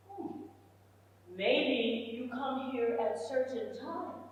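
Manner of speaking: soft, hesitant mock manager reply; evasive manager. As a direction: imitate a manager quietly offering an excuse. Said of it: "talk to the manager".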